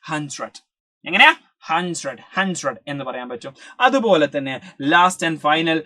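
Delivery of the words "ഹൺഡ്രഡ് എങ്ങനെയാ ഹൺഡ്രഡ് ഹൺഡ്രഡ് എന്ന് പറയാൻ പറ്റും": "ഹൺഡ്രഡ്" said with dr turning to jr, "hun-jred"; jr-sound hundred. Shown as a demonstration. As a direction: let the d slide into a j. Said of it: "ജെ ആർ ആയി മാറുന്നുണ്ട്".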